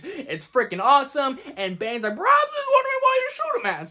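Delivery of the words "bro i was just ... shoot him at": much higher, muffled villain voice; playful Bane impression. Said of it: "I'm just gonna keep doing it".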